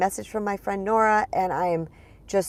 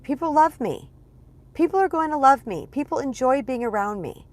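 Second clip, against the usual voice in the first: high-pitched positive self-talk; pep yourself up in a raised, insistent voice; upbeat affirmation; 6 semitones higher than usual. Retold or imitated. Woman reassures herself on the way to a function, upbeat affirmation.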